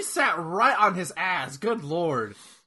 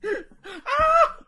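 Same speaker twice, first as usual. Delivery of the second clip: mock sob; 5 dB louder than usual. Fake sobbing laugh.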